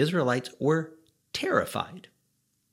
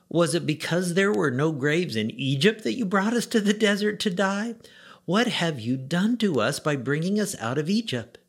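higher, sarcastic griping at Moses; bitter complaint; 6 semitones higher than usual. Acted. Israelites trapped at the sea complain to Moses, high bitter whine.

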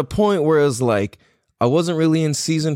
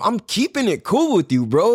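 usual speaking voice

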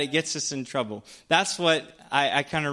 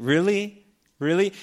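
mock disbelief; 5 semitones higher, 4 dB louder than usual. Mock disbelief at Aaron's idea.

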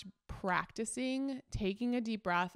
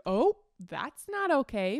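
louder, slow mock telling-off; mock scolding; 4 semitones higher, 7 dB louder, slower than usual. To her husband after a joking hit, louder mock scolding. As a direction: playfully scold him for the hit. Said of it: "I jokingly, like, kinda hit him".

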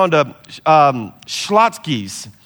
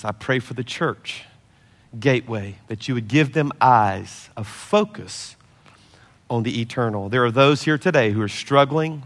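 closing prayer; 4 semitones lower than usual. Acted. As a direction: pray over them gently, lingering on words. Closing prayer over church.

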